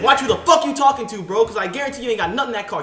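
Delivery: loud, high, quick retold challenge; confrontational. Cyclist challenging a man in a car, confrontational. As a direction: fire it off loudly, squaring up to someone.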